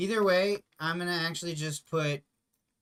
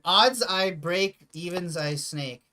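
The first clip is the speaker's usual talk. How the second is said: slow and deliberate, louder on the first half; drawn-out game announcement; 3 semitones higher, 6 dB louder, much slower than usual. Real, not acted. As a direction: spell out the roll rule like a ruling. Announcing the die roll rule.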